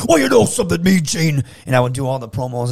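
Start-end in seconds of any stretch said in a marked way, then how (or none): 0.1-1.4 a bit higher and louder, a lightly done wrestler interview; wrestler promo voice. Retold or imitated. Kid imitating a wrestler in a promo with Mean Gene, lightly done. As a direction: puff up like a wrestler talking to the interviewer. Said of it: "try and do all the voices".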